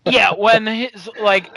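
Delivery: ordinary delivery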